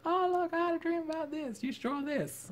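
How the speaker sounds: high, squeaky mock-eager client voice; imitating excited dreamer. Teasing friend who wants a dream drawn, high eager voice. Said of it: "how usually most art turns out".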